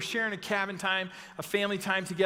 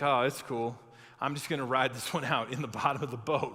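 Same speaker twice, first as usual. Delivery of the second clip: low, easygoing imitation of an unbothered man; chilled-out Jesus; 7 semitones lower than usual. Preacher voices Jesus chilling in the boat, laid-back. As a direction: play it laid-back, pitched well down. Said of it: "Jesus is just chilling".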